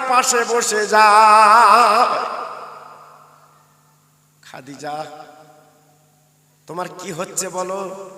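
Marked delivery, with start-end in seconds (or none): 4.5-8.1 hushed, low questioning; tender concern. Acted. Husband at his sick wife's bedside, soft tender asking. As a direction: drop right down and ask softly.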